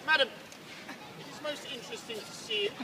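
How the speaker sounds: normal talking voice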